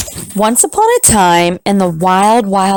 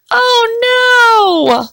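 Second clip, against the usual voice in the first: startled cry. Acted. Wife cries as cookie escapes.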